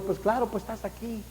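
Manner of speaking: put-on a father gently lifting a child up; gentle answer. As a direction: reassure him softly. God answering the sinner.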